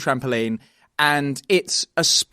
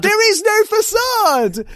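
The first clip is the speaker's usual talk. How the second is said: indignant protest; 4 dB louder, slower than usual. Real, not acted. Protesting the accusation.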